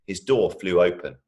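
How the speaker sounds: ordinary delivery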